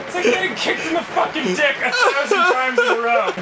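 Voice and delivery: high, yelled complaint with laughter; laughing, worked-up outrage; exasperated rant. Real, not acted. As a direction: wail about the unfairness. Rants about losing repeatedly.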